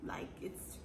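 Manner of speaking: unvoiced whispered aside. Real, not acted. Telling listeners she left him on read, whispered aside. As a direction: drop to a whisper.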